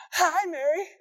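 high, singsong hello, rising at the end; overeager gentle greeting. Acted. Gabriel trying not to scare Mary, overeager greeting. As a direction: greet with barely contained sweetness. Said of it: "he's doing his best to go".

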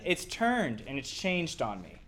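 normal talking voice

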